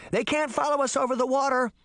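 high, urgent calling voice; urgent cry. A narrator's reading. Sabrina directing Puck mid-flight, crying out urgently. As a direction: keep calling out, pitched high. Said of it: "Sabrina cried".

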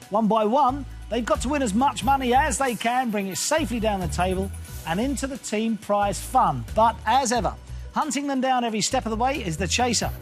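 usual speaking voice

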